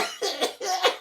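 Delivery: short high laugh after the punchline. Real, not acted. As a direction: laugh high at the end of the joke.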